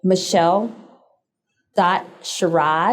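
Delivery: spaced out for note-taking, rising at each pause; very slow. Real, not acted. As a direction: say it slowly, piece by piece. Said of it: "Say that again very slow".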